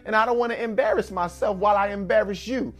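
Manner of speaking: stern mother threat. Retold or imitated. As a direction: threaten the boy like a fed-up mama. Voicing a mother in public.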